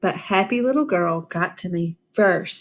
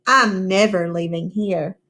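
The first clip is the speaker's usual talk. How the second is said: usual speaking voice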